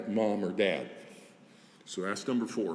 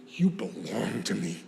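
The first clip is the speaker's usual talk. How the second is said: possessive whisper; 4 semitones lower than usual. Shown as a demonstration. Adoptive parent whispering to the child, tender and possessive. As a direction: breathe it to the child.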